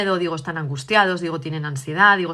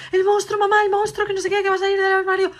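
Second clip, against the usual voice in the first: put-on much higher, louder voice of a scared little girl; frightened little girl; 13 semitones higher, 5 dB louder, faster than usual. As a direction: play the panicked child pleading with mum. La hija asustada llama a mamá. (niña asustada, voz mucho más aguda y fuerte)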